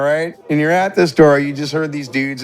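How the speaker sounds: ordinary talk, nothing marked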